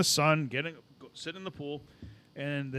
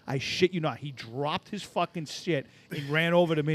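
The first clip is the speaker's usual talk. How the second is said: ordinary talk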